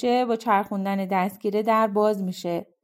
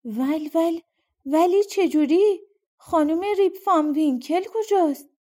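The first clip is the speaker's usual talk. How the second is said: stammering; 6 semitones higher than usual. Acted. Rip asking the stranger where his wife is, stammering and higher. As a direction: stumble over the first word, bewildered. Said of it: "تته پته کنان گفت".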